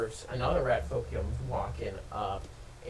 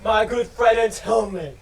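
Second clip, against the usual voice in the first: rat king calling; 8 semitones higher, 9 dB louder than usual. Acted. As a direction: call him back like a vain ruler. Rat king calls player back.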